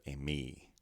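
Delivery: "a me" with the weight on me; "a me", light a then stressed me; stress on second syllable. Shown as a demonstration. Teacher putting the sounds together, "a me" stressed on me. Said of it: "because of the acute accent".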